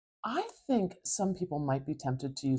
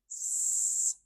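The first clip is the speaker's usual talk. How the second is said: long voiceless "s" hiss. Shown as a demonstration. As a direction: hiss the "S" sound alone. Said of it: "that really high frequency sound, the s".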